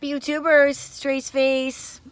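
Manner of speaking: hoarse, worn-out voice; raspy lost voice. Real, not acted. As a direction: talk through a voice lost from yelling. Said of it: "like, raspy".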